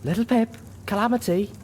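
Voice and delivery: high, anxious call for attention; whimpering. A narrator's reading. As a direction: call your friends with a whimper. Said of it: "Velvet whimpered".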